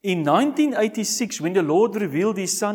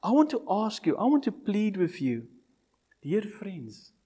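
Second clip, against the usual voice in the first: ordinary talk, nothing marked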